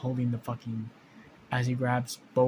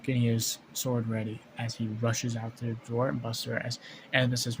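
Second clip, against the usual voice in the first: ordinary delivery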